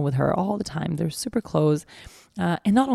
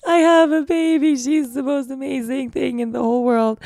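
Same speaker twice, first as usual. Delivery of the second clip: high, loud tearful outburst; crying; 7 semitones higher, 5 dB louder than usual. Retold or imitated. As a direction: sob it out to the cab driver. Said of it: "I full on started crying".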